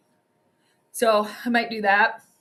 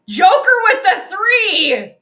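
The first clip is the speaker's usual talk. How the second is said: loud, high, drawn-out call of the shot; stretched play-by-play yell at a big three; excited shout; 7 semitones higher, 9 dB louder, slower than usual. Real, not acted. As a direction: belt it out like a fan watching the game. Big shot in live game.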